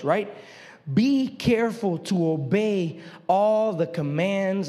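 slow, grave royal charge; solemn proclamation. Acted. David charging Solomon before all Israel, slow and solemn. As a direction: proclaim it like a king from a balcony.

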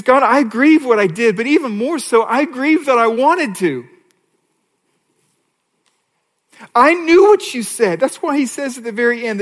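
high, loud, impassioned preaching of David's plea; preaching at full force. Acted. Preacher voicing David's confession to the congregation, impassioned and loud. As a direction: pour it out like a pulpit climax.

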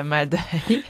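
normal talking voice

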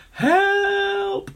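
shrill high help; high cry for help. A narrator's reading. Henry plummeting to the ground, panicked cry. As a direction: cry out high as you fall.

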